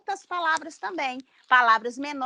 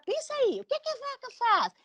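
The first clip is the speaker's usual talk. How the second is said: much higher, sing-song question; playful question to a child; 12 semitones higher than usual. Shown as a demonstration. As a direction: lift up high as if asking a little child. (pergunta brincalhona bem mais aguda para a criança)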